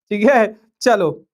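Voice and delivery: usual speaking voice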